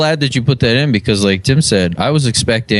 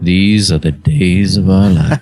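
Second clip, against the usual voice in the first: slow, low mock soap-opera intro; soap opera announcer; 3 semitones lower than usual. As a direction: intone it like a soap announcer, drawn out.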